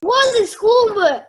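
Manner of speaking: loud, slow shout; loud slow order at a dog. Acted. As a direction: drive the dog out with a yell. Chasing dog out of flowerbed.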